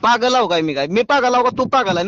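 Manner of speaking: usual speaking voice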